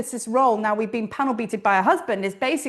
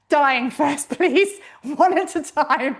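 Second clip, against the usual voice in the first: laughing plea; 3 semitones higher, 3 dB louder, slower than usual. Real, not acted. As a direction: crack up while begging for mercy. Laughing at own retold plea.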